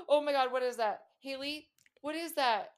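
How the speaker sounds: high, rising panic at what she sees; frightened. Real, not acted. Watching a horror clip with her friend, frightened. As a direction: voice climbing in real fright.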